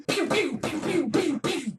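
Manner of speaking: high, soft rapid pews; laser sounds. Acted. Angry prophetess firing pretend lasers, high and quiet. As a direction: fire off little cartoon laser noises. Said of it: "start making laser sounds".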